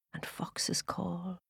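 slow hushed whisper. A narrator's reading. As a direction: breathe it out slowly. Spooky forest narration.

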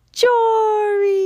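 high, loud glad shout; the children spotting the lost baby at last; joyful cry. Acted. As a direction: relief bursting out. Finding Jory in the clearing.

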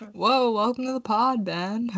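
a hint of Ben Affleck; lightly voiced laid-back guy; Ben Affleck impression. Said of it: "That's my Ben Affleck impression".

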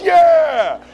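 loud group cheer; excited. Retold or imitated. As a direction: yell yeah with the crowd. Everybody cheering.

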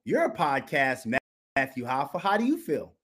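ordinary talk

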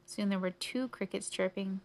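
usual speaking voice

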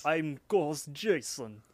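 put-on slow Russian-accented introduction. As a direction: introduce yourself slowly like a Russian. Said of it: "in a Russian accent".

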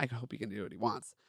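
softly corrected reread, stress on he; quiet correction. Shown as a demonstration. Reader quietly corrects her misread line. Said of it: "Okay, please. Not".

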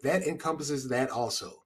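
ordinary delivery

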